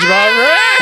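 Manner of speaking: high, loud scream; kid's scream. Retold or imitated. Retelling himself running around the house, screaming. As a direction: shriek in mock terror. Re-enacts his childhood screaming.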